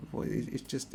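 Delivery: normal talking voice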